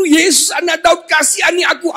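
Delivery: high calling plea to Jesus; crying out. Acted. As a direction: call out to him from the roadside. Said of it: "Waktu Bartimeus berseru". (berseru tinggi memohon)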